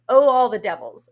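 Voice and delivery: loud acted oath; theatrical curse. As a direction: burst out cursing as a wronged husband. Cursing in the raging monologue.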